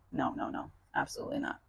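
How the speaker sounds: breathy whispered no, no, no; whispered refusal. Real, not acted. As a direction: hiss it under your breath, firmly refusing. Refusing to use dirty water.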